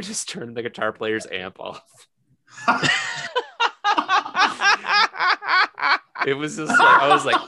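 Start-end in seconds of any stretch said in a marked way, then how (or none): 2.6-6.2 loud, high burst of real laughter; real laughter at the story's punchline; big laugh. Real, not acted. Friend cracks up recalling the singer's prank, laughing.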